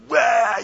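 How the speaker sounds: high, loud cheering whoop; excited fan yell. Retold or imitated. Preacher imitating a cheering Packer fan, loud whoop.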